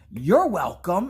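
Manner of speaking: huffy retort. Retold or imitated. Offended mom to her unimpressed son, huffy. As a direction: toss it back with theatrical pique.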